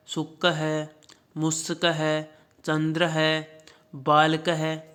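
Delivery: each word drawn out slowly for pupils; slow, careful reading for children; slow recitation. Shown as a demonstration. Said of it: "इन सभी के उच्चारण करना".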